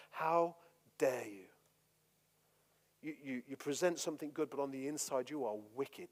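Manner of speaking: low, intense, seething rebuke. Retold or imitated. Jesus eyeballing the religious elite, quietly seething. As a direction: hold them with your stare. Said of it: "he's eyeballing them".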